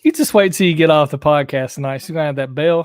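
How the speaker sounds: ordinary delivery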